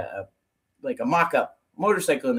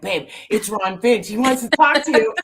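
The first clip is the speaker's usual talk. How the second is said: excited urging; 7 semitones higher, 3 dB louder than usual. Retold or imitated. Urging husband on the phone.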